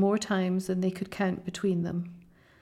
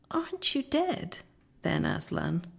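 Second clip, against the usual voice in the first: frightened wonder. A narrator's reading. Children staring up at Aslan, frightened wonder. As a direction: ask it timidly, pitched well up.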